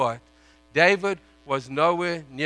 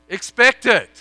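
emphatic warning; 8 semitones higher, 14 dB louder than usual. Real, not acted. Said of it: "emphatic warning".

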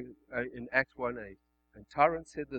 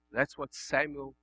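ordinary delivery

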